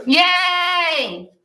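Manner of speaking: high, drawn-out happy cheer; long, high "yay" of delight; excited cheer. Real, not acted. Cheers visitors coming in October.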